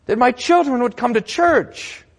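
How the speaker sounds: high, insistent, piling-up complaint; anguished churchgoer. Acted. Churchgoer asking pastor about unanswered prayer, pleading complaint. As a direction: plead upward, one trouble after another. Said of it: "I get this question from you".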